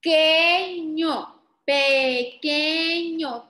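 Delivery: "Pequeño" slowly as PE... KE... ÑO; teacher's slow, deliberate "Pequeño"; syllable by syllable. Shown as a demonstration. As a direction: stretch each syllable of "Pequeño". Teacher sounds out word by syllables.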